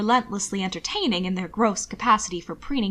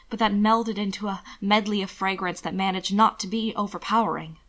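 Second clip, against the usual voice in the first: ordinary delivery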